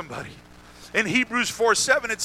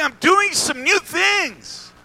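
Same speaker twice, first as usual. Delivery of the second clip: high, drawn-out call; stretched-out, high-pitched proclamation; proclaiming; 7 semitones higher, much slower than usual. Acted. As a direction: announce it slowly in a raised, calling voice. Preacher voices God's announcement.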